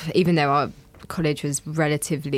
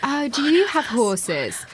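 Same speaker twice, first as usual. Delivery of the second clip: posh voice; 8 semitones higher than usual. Retold or imitated. Imitating posh people's questions.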